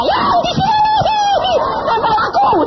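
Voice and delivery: very high shrieking woman's voice; wife screaming. Retold or imitated. Wife screams the demon is clinging to her, high shriek. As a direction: shriek it, terrified, in a woman's pitch. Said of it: "istri saya teriak-teriak".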